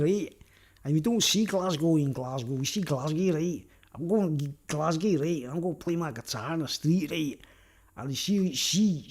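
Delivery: broad comic Glaswegian impression; Glaswegian accent. Shown as a demonstration. Showing off accents to viewers, mock Glaswegian. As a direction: put on a thick Glasgow accent. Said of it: "That's my Glaswegian accent".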